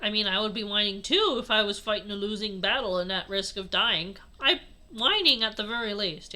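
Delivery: plain reading voice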